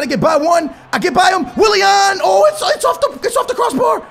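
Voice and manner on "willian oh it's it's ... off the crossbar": very high rising cry; near-miss shock. Real, not acted. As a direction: gasp out the crossbar miss. Shot hits the crossbar.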